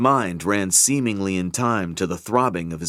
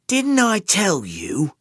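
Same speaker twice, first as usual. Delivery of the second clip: slow, high, threatening demand; menacing demand; 11 semitones higher, slower than usual. Acted. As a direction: lean in and demand it. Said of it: "Sunter demanded".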